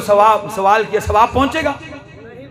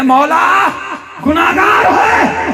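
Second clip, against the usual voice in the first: high, loud, slow ringing proclamation; voicing God and angels; 12 semitones higher, 4 dB louder, slower than usual. Preacher voicing God answering the angels, high ringing shout. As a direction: call it out like a divine decree.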